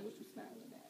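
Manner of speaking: hushed, voiceless retort with a laugh in it; whispered mock challenge; muttered challenge. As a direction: mutter it under your breath. Muttered at someone smiling, retold.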